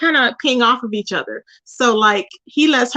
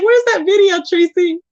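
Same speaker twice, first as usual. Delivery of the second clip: high, whining mock plea; a viewer begging for the deleted video, mock whining; mock whiny fan; 7 semitones higher than usual. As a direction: whine it like a disappointed fan. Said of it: "They'd be like".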